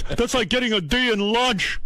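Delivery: high-pitched mock dad rant; imitated yelling father. Father confronts son over report card, mock yelling. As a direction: scold like an exasperated dad, voice pushed high. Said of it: "he just fucking yelled at me".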